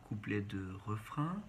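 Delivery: ordinary talk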